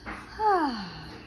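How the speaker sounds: long relieved sigh. Acted. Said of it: "came out with a sigh".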